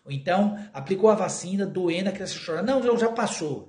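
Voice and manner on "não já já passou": mock hurried comfort. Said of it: "Já tá mentindo de novo".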